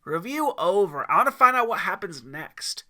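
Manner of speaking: put-on loud impatient kid shout; kid yelling. Timmy yells at the mountain man to get on with the story. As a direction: yell it like an impatient boy. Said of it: "Timmy yelled".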